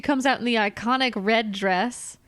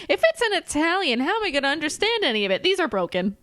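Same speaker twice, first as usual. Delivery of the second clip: high, fast, flustered rush; higher-pitched, rushed mock panic over the opera; her exact delivery; 6 semitones higher, faster than usual. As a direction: blurt the confused complaint quickly. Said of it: "That's the exact delivery she does".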